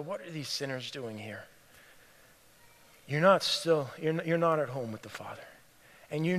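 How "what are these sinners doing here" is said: low-key, soft judgmental mutter. Acted. Older brother judging the sinners, muttering quietly. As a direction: mutter it under your breath. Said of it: "maybe you often mutter as well".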